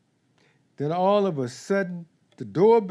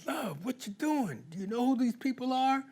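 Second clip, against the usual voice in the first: alarmed disbelief; 7 semitones higher, 7 dB quieter than usual. Pretend. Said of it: "And you're saying".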